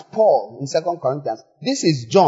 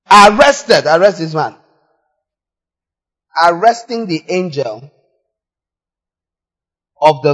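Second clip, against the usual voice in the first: louder arrest order; 6 dB louder than usual. Acted. Preacher acts out the arrest.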